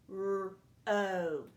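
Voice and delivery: P-R-O said sound by sound; P-R-O stretched out slowly sound by sound. Shown as a demonstration. Teacher sounding out P-R-O to find the vowel. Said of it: "Listen for that vowel sound".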